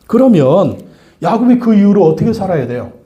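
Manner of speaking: normal talking voice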